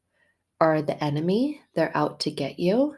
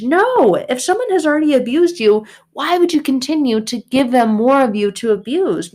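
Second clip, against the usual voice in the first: high, loud, outraged ranting at God; angry rant; 5 semitones higher, 4 dB louder than usual. Retold or imitated. Retold self rants at God over loving enemies, loud and outraged. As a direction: shout it in disbelief, fed up. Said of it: "And I'm like".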